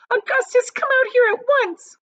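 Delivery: high, loud scolding yell; frantic yell. A narrator's reading. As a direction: demand he come out at once. Mother shouting into the pipe.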